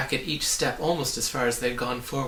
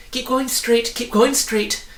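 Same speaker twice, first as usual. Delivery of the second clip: much higher and louder call; 11 semitones higher, 7 dB louder than usual. A narrator's reading. Hidden voice guiding the travellers, calling out. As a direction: call them toward you. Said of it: "counseled the voice".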